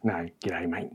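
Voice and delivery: soft, low, understated Australian "g'day, mate". Shown as a demonstration. Player correcting an accent demo, quiet Australian. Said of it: "That's how they sound".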